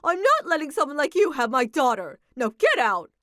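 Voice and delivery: loud, harsh mother throwing him out; stern refusal. Acted. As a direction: order him out of the house. Mother rejects daughter's fiancé.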